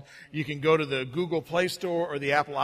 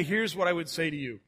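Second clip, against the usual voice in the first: ordinary talk, nothing marked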